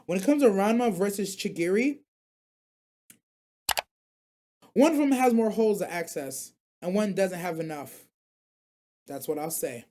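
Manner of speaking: measured delivery with deliberate pauses; tactful, held-back verdict; careful. Real, not acted. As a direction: choose each word carefully, pausing before the punchline. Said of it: "I gotta say this carefully".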